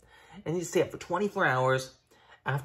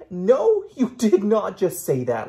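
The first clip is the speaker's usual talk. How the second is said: sassy mock outrage; 5 semitones higher, 9 dB louder, slower than usual.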